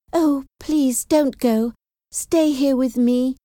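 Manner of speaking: high, imploring sickbed plea; pleading cry. A narrator's reading. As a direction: beg your sister to stay, pitched well up. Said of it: "Jane cried".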